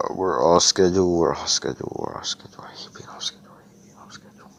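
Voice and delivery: fretful repeat fading to a whisper. Retold or imitated. Heath frets over lost time, fading into a whisper. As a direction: mutter it over and over until it's barely breath. Heath fretting about schedule.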